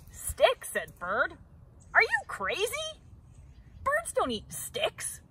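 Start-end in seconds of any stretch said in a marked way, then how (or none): 0.1-0.7 shocked high "Sticks?"; can't believe the offer; incredulous. A narrator's reading. 1.9-5.2 high, outraged scoff; mock the very idea; scornful disbelief. A narrator's reading. Bird scoffs at Beaver.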